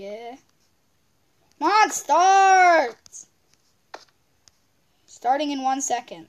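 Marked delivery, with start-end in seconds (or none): 1.6-3.0 loud, high, drawn-out urging; impatient shout. Real, not acted. Player waiting for the game to begin, impatient shout. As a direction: urge it on, stretching the words.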